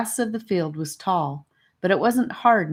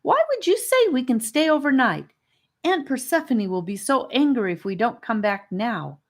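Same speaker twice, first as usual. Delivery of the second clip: accusing; 7 semitones higher than usual. Acted. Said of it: "Annika immediately turned on Charlie".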